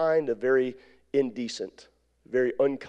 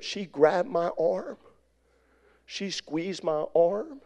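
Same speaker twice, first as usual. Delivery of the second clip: slow dramatic buildup; 6 semitones higher, much slower than usual. A narrator's reading. Tense buildup, slow delivery.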